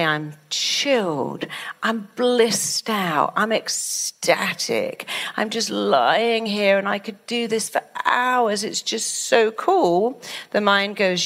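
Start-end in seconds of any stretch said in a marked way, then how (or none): 0.0-10.2 very slow, stretched blissful calm; chilled out. Shown as a demonstration. Speaker showing blissful self-talk, slow and dreamy. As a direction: drawl out each word like lying in bed. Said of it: "When you say".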